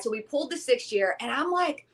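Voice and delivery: ordinary talk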